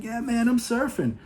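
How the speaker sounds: thrilled he finally caught a wave; imitating a stoked beginner's cheer; triumphant whoop. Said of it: "he's like".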